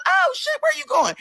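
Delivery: very high-pitched, nosy amazement; exaggerated surprise. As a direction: squeal it in mock astonishment. Reacting to owner's vacation.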